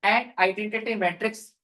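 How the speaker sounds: very slow, drawn-out words for note-taking; slow dictation. Real, not acted. Teacher dictating a matrix rule to students, slow dictation. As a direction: dictate phrase by phrase with long pauses.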